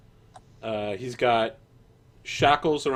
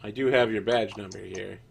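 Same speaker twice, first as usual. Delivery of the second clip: jailer voice; 5 dB louder than usual. Acted.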